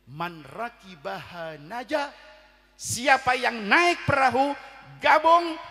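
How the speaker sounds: slow, loud, rising sermon call; preacher calling the faithful aboard; fervent preaching. Acted. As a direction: urge them to board the ark. Preaching at full force.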